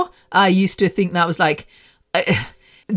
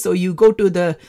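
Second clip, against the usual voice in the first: put-on South Indian accent; imitated Indian accent. Your doctor from South India, imitated Indian accent. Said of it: "people that talk like this".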